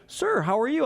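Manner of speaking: high polite greeter voice; chirpy mock store greeter. Greeter politely stopping him at the door, high voice.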